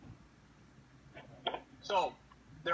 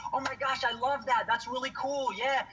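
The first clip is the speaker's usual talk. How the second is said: gushing customer; 7 semitones higher than usual. Retold or imitated.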